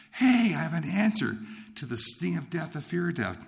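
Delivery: high, lifted eureka; retold excited realization. New believer finds an answer to death, excited. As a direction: announce a discovery with rising excitement.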